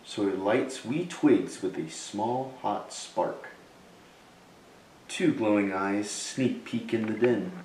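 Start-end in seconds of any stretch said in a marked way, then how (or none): none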